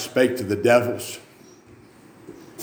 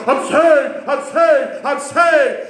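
high, loud jubilant cries; James; 5 dB louder, much slower than usual. Retold or imitated. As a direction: imitate a new convert shouting it over and over. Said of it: "James would sit over there and go".

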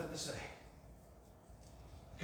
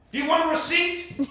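loud, high, angry question; mad demand; 10 semitones higher, 8 dB louder than usual. Retold or imitated. Dale offended by pastor's generous trust, mad demand. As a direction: demand it hotly, rising at the end. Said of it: "You know, I get all mad".

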